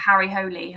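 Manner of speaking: "Holy" as HOH-lee; name the detective with "Holy" ending in ee; correct pronunciation. Shown as a demonstration. Host using the confirmed name, "Holy" in two syllables. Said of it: "holy is the correct pronunciation".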